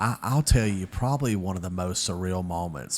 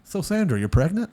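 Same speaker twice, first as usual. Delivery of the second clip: higher, louder ribbing voice; mock teasing buddy; 10 semitones higher, 6 dB louder than usual. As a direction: needle him like a smug friend. Guy teasing him in front of everyone.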